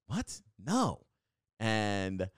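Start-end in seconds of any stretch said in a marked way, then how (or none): 0.1-1.1 sudden high "What? No." in mock surprise; startled reply. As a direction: act caught off guard, higher. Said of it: "I go".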